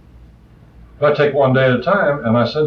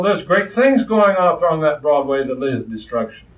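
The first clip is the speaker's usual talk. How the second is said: ordinary talk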